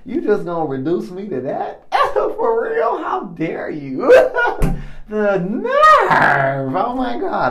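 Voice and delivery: laughing, high-pitched mock indignation; mock offended. Man reacting to mentor's backhanded praise, mock offended. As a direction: protest in hammed-up outrage, breaking into laughs. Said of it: "part of me wanted to be offended".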